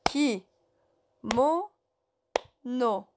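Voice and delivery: "Kimono. No." as three separate syllables, ki, mo, no; syllabe par syllabe. Shown as a demonstration. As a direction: slowly break "Kimono. No." with a pause after each syllable. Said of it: "trois syllabes". (« Kimono. No. » en trois syllabes bien séparées)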